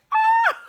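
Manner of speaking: loud high-pitched scream of fright; startled scream. Retold or imitated. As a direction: shriek like you just got scared. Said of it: "And then I'm like".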